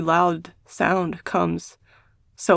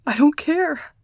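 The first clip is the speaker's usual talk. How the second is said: higher, tearful insistence; tearful, pitched-up protest; crying defiance; 8 semitones higher, slower than usual. A narrator's reading. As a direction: refuse through tears. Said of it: "I realize I'm crying".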